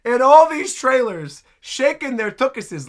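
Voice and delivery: high, loud incredulous outburst; real shock at what is on screen; shocked disbelief. Real, not acted. Startled reaction to trailer.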